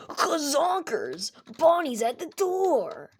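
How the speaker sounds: loud, high, drawn-out mock scream; hammed-up panicked shout. Michael seeing Bonnie at the door, hammed-up panicked shout. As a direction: yell the alarm in cartoon terror.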